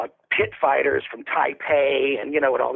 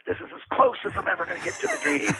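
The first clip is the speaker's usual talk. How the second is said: mock despair; 6 semitones higher, 3 dB quieter, faster than usual. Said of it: "I'm like".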